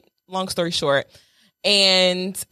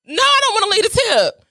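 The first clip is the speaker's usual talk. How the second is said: indignant refusal; 10 semitones higher, 3 dB louder than usual. Real, not acted.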